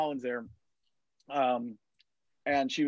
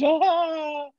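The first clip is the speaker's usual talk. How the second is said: mock swoon shriek; 12 semitones higher, 5 dB louder than usual. Women fainting for Hemsworth.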